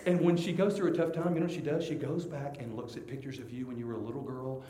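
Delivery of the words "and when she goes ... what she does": usual speaking voice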